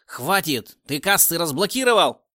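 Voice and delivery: loud impatient outburst; angry shout. A narrator's reading. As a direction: cut him off, furious. Boss cuts him off.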